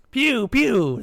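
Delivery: high, loud toy laser noises; playful high-pitched shooting sounds; children yelling. A narrator's reading. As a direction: yell it like kids at play. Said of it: "the children yelled".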